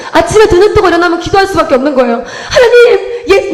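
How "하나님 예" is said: tearful laughing prayer. Retold or imitated. Recalled tearful morning prayer.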